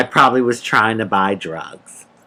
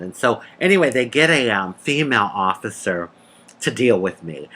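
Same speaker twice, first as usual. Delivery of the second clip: ordinary talk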